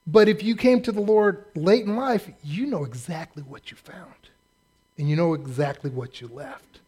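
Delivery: ordinary talk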